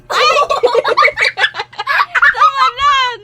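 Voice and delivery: bursting into loud laughter; laughing. Real, not acted. Sudden laugh at baby.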